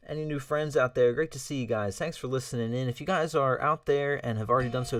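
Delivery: ordinary talk, nothing marked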